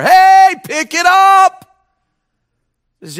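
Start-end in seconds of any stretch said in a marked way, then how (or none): 0.0-1.9 high, loud call to the crowd; rally them to respond; preacher shouting at the pews. Real, not acted. Urging the congregation on.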